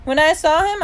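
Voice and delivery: put-on high, loud child's retelling; excited insistence. A narrator's reading. Little brother tells mom of his fright, excited.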